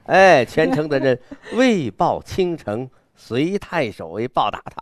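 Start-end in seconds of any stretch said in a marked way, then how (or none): 1.5-4.1 bold recitation. Shown as a demonstration. Teacher demonstrating the poet's wildness to a student, bold declamation. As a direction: proclaim it proudly to the whole town. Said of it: "你看这个狂".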